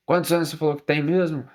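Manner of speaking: lightly voiced older lady on the phone, a bit quick; a hint of an older woman asking doubtfully; older woman operator. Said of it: "uma voz de uma senhora".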